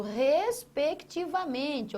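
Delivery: "respectivamente" broken slowly into syllables; deliberate emphasis. Shown as a demonstration. Teacher stresses the order of words, deliberate emphasis. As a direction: spell out "respectivamente" beat by beat. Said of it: "nesta ordem".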